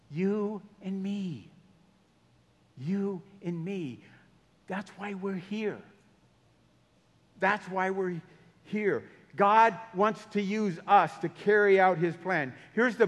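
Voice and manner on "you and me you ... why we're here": hushed. Real, not acted. Intimate appeal to congregation.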